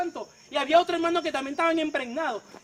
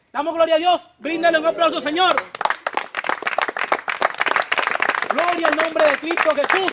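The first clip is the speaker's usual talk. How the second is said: louder call to applaud the Lord; rousing praise; 7 dB louder than usual. Real, not acted. Preacher calling the church to applaud God, loud rousing praise. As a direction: lift the congregation with full voice.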